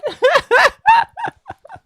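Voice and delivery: high shrieking laughter. Real, not acted. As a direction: burst into a squealing laugh. Laughs at crying clip.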